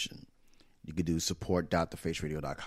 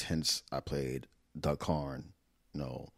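usual speaking voice

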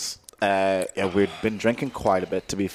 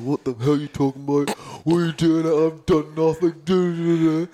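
imitating a drunk mate who denies everything; drunk indignant protest; 8 semitones higher than usual. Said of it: "Luke's like".

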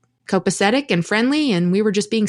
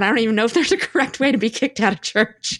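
laughing; 5 semitones higher than usual. Real, not acted. Laughs at being kicked out.

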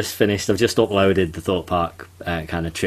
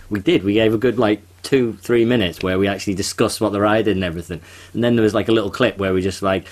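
ordinary talk

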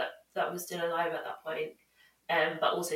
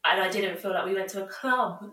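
normal talking voice

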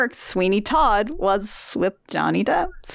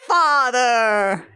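hammed-up melodrama; much slower than usual. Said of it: "hammed-up movie drama".

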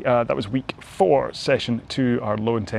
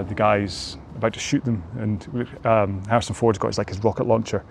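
ordinary delivery

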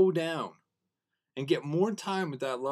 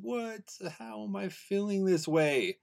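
euphoric amazement; 5 semitones higher, slower than usual. Retold or imitated. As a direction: relive being amazed by how good it feels. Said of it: "It was, like, euphoric".

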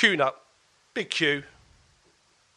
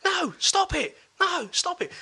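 retold shouting; slower than usual. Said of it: "I heard a girl shouting".

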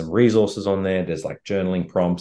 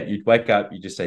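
ordinary delivery

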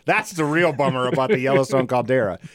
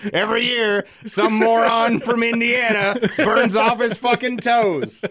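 higher, slower, laughing mock local voice; mock Hawaiian local; 8 semitones higher, 3 dB louder, slower than usual. Host imitates Hawaiians scoffing at tourists, laughing higher voice. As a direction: voice the locals mockingly through a laugh, slowly. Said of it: "they're like".

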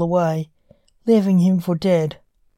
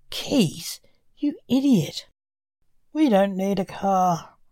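scornful. Acted. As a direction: sneer at his foolishness. Reaper scorns son's car idea.

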